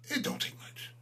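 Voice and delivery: a sly whispered repeat; whispered taunt. Acted. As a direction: whisper it, mocking and breathy. Repeating his line as a taunt.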